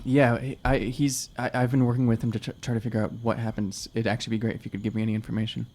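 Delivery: normal talking voice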